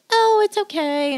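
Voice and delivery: high, slow coddling voice; mock soothing. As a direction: coo reassurance to the dogs. Soothing the dogs, opposite of negative.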